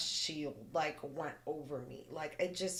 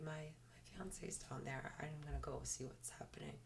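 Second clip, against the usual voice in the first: hushed, voiceless whisper; 13 dB quieter than usual. Retold or imitated. To the scared girl, whispering she will go check. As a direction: breathe it out barely voiced. Whispering before going down hallway.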